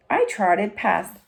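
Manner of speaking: usual speaking voice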